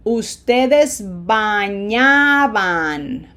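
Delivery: bañabas to bañaban, very slow with long open A's; slow drill, stretching the stressed ñA in bañábamos. Shown as a demonstration. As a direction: hold each "ah" in bañaba forms, never shortened. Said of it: "We don't wanna shorten them".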